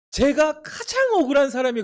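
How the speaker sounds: high, loud cry of unfairness; shouted exclamation. Real, not acted. Pastor exclaims his grievance.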